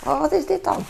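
high, puzzled asking; baffled questioning. Retold or imitated. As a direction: act confused about the race. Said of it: "en dan zeg ik".

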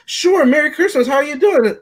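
mock sugary retail greeting; mock-cheerful clerk greeting a customer; chirpy service voice. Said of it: "they want you to say".

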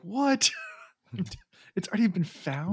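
high, startled disbelief; shocked disbelief. Retold or imitated. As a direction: squeak it out in shock. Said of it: "And Fael's just like".

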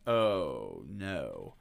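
deep, drawn-out oh no; low dismayed groan. Retold or imitated. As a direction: feel it all going wrong. Viewer's dismayed reaction.

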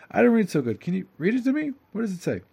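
simple man asking. Acted. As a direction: plead like a simple man who can't read.

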